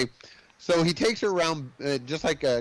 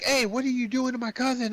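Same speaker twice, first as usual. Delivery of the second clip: put-on protective kid; 10 semitones higher than usual. Kid cousin confronts the cook.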